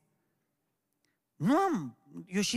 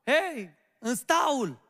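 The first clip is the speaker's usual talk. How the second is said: raised, loud herding call; shepherd's shout; 5 semitones higher, 5 dB louder, much slower than usual. Acted. Shepherd yelling at his sheep to get into the pen, shouted. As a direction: call the sheep back into the fold. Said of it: "Când păstorul țipă".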